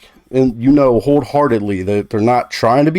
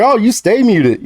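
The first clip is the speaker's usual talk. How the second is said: a light, teasing jab, pitched up; joking tease; 9 semitones higher than usual. Retold or imitated. Joking to clanmate, playful teasing. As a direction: tease a friend who muted herself. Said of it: "I said jokingly".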